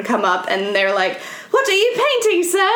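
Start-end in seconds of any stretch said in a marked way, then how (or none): 1.5-2.7 high-pitched imitation of curious pupils; French schoolchildren. Schoolchildren come up to the painter on the roadside, high imitated child voices. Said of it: "this French woman and, like, all of her school children".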